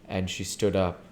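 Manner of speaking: ordinary delivery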